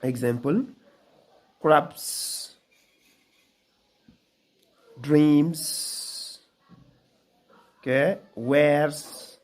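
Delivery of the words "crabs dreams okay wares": "crabs", "dreams" ending in a buzzed "z"; dictionary pronunciation. Shown as a demonstration. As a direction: read each word slowly, ending in a voiced "z". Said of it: "this Z word is voiced".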